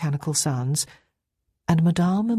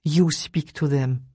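slow, mildly dismissive handover; washing her hands; slower than usual. Acted. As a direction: hand it over as if done with it all. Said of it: "as though washing her hands of everything".

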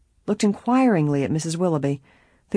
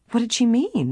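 high-pitched, curious question; puzzled question; 7 semitones higher than usual. A narrator's reading. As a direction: ask it with startled curiosity. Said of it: "Nancy asked".

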